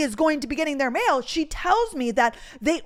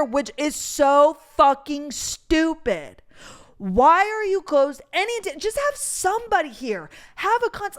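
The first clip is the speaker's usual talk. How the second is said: loud, high exasperated rant; really upset; 4 semitones higher, 6 dB louder than usual. Real, not acted. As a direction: vent in frustration, hitting words hard. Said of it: "I wouldn't get that upset".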